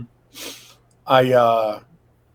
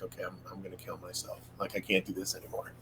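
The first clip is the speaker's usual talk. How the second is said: much softer, hushed resignation; quiet despair; 4 semitones lower, 14 dB quieter than usual. Retold or imitated. Telling of his lowest night, a hushed, softer resigned voice. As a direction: say it under your breath, done with everything. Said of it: "and I'm like".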